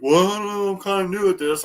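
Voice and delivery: high, meek mock voice of a clueless boater; lightly mocking beginner; sheepish newbie. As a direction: play him small and apologetic. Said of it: "He's like".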